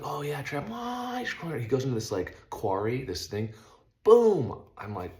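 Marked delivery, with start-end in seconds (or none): 0.0-1.6 rising high, pumped praise; impressed cheer. Retold or imitated. Cheering on Trav's lap. 4.0-4.6 loud high imitated boom; crash sound effect. Car hits quarry rocks.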